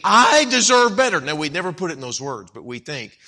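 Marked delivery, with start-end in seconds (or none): 0.0-1.2 loud, high mock demand; hammed-up indignant self-importance; entitled. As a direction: proclaim it as an entitled complainer. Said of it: "We get an entitlement mentality".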